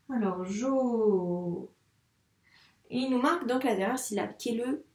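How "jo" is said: "jo" with a very long O; sounded-out syllable. Shown as a demonstration. Teacher sounding the syllable just written, drawn out. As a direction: stretch the vowel of "jo" slowly. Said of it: "On veut jo".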